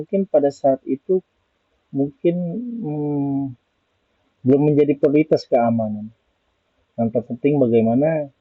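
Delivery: ordinary talk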